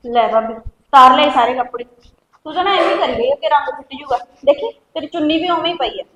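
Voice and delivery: loud, carefree call across the house; cheerful calling out. Acted. Sister-in-law's sister calls out loudly, unaware of trouble. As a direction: call out brightly from the other room.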